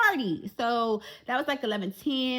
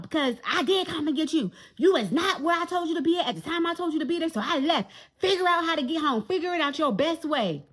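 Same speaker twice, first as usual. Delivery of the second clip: mom scolding, louder; 5 semitones higher, 3 dB louder than usual. Retold or imitated. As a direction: play the fed-up mother telling her off. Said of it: "why [name] talk about".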